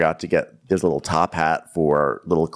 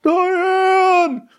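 much higher, louder sung-out calling; mock movie shout; 5 dB louder than usual. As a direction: belt the name like The Graduate finale. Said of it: "jumps out of the pew ... is like".

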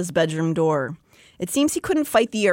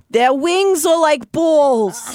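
slow, louder, higher dramatic read; mock solemn reading; 7 semitones higher, 6 dB louder, much slower than usual. [name] reading erotica aloud, slow mock-dramatic delivery. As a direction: draw out each word like a grave revelation. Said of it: "he was saying".

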